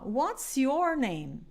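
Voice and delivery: What's your name very slow, your as or; slow model phrase. Shown as a demonstration. Teacher demonstrating phrase slowly to students. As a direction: lay it out slowly. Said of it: "eu falo assim".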